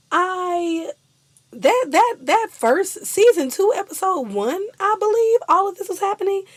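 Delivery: high, loud excited rush. Real, not acted. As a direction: tumble out the memory.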